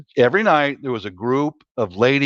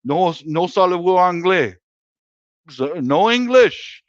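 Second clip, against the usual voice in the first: mock French; 6 semitones higher than usual. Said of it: "sound like I speak French".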